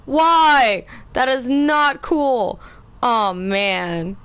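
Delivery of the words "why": loud, high cry of dismay; dismayed shout. Real, not acted. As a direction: wail at the unfair death. Character dies.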